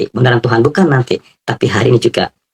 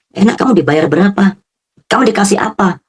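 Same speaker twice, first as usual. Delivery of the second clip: higher, prying mock voice; nosy questioner; 10 semitones higher than usual. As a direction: imitate someone fishing for gossip. Quoting a nosy acquaintance.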